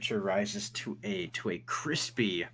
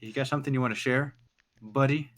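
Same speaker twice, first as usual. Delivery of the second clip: suspicious; 5 semitones higher, 5 dB louder, faster than usual. Acted. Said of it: "he's like".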